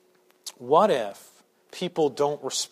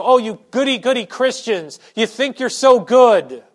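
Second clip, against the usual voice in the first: mocking sneer; 6 semitones higher, 10 dB louder than usual. Preacher voicing the world's scorn, mocking. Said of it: "they go".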